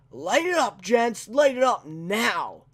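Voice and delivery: loud, high mock command to open fire; villain's order. Quoting the villain ordering his men, a loud mock command. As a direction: bark it like a cocky henchman. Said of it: "It's not as good".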